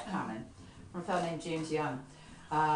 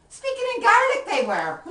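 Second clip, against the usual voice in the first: mock horror; 13 dB louder than usual. Climax of horror in poem.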